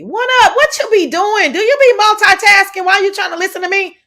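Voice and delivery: high, fast teasing impression; mocking high voice. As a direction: squeak it out, mocking. Mimicking Lemon's greeting on live.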